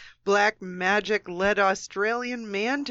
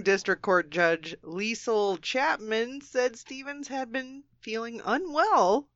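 ordinary talk, nothing marked

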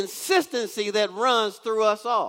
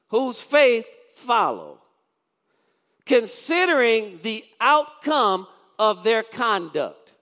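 slow reading; slower than usual. Shown as a demonstration. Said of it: "read that real slow".

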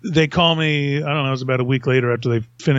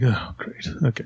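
unimpressed mutter; 2 semitones lower, 6 dB quieter than usual. Retold or imitated. Sarcastic reaction to longer script.

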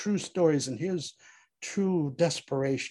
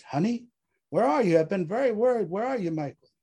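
higher, anxious wife on the phone; worried, pitched up, louder at first; 8 semitones higher, 3 dB louder, faster than usual. A narrator's reading. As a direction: plead to know where he is.

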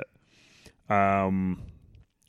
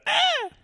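high mock shriek; 4 dB louder than usual. Mock horror-movie scream.

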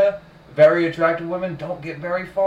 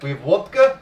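put-on Russian accent; 3 semitones higher than usual. Friend joking about Russian life, mock Russian accent. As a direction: list it out like a proud Russian. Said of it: "Did not like either of your Russian accents".

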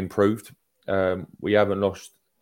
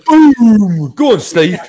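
much higher and louder, a cheering roar; excited shout; 13 semitones higher, 13 dB louder, much slower than usual. Real, not acted. Cheering his co-host's point with a loud shout. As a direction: bellow it like the winning point just landed. Celebrating a mic-drop point.

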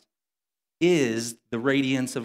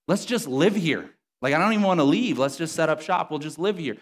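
higher-pitched, eager outburst; excited Peter; 5 semitones higher, 4 dB louder than usual. Retold or imitated. Disciple begging to stay on the mountain, excited. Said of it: "Peter's so excited about what's happening".

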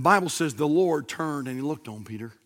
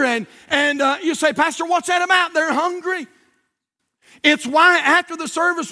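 high, impassioned pulpit delivery; fervent, raised revival-style sermon; full-force preaching; 11 semitones higher, 6 dB louder than usual. Real, not acted.

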